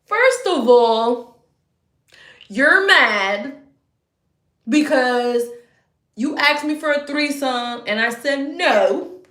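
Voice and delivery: loud, slow, emphatic call-out; heated confrontation. Real, not acted. Calling out the ex to the camera, loud and slow. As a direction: lay into him word by word.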